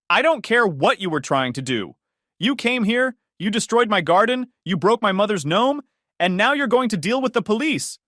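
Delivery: high, loud accusation; furious threat. Acted. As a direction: list the crimes then threaten. Joyce threatens police.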